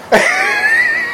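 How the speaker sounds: a burst of shrill high laughter; real laughter that jumps high into a shriek. Real, not acted. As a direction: cackle way up high at the punchline. Punchline about Andy lands.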